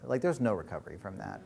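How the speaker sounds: ordinary talk, nothing marked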